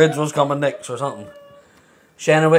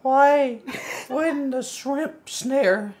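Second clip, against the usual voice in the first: high, slow put-on maid of honor; 9 semitones higher, 5 dB quieter, much slower than usual. Maid of honor giving a wedding speech, high and drawn-out. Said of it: "like you're the maid of honor".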